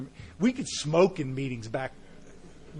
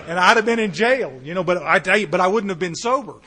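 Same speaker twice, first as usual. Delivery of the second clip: emphatic; 3 semitones higher, 8 dB louder than usual. Real, not acted. Emphatic loud testimony.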